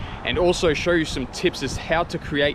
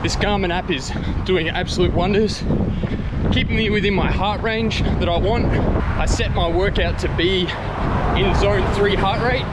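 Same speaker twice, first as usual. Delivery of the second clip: out of breath; 6 semitones higher, 4 dB louder than usual. Real, not acted. Talking mid-run.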